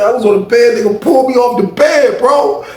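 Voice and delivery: high, loud whining complaint; imitated wounded protest; mock aggrieved wail. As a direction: whine it high like the hurt victim. Imitating hurt man's complaint.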